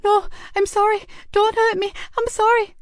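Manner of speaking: put-on high, panicked pleading boy; terrified boy crying out not to be hurt; frightened cry. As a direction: beg in terror, voice up high. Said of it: "cried out in fear and pain".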